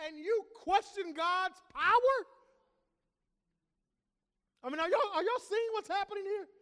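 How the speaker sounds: high, loud, amazed disbelief; can you believe this, laughing; incredulous preaching. Real, not acted. Preacher marvels at Gideon.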